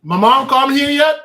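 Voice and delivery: high, loud, eager mother calling out; excited mother imitation. Son imitating his happy mother calling, eager and high. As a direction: call out like his thrilled mom asking for her son.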